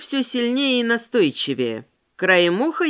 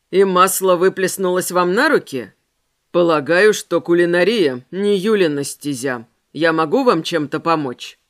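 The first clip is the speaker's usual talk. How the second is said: lower man's voice; 5 semitones lower, 4 dB louder than usual. Acted. Ramirez voiced by narrator.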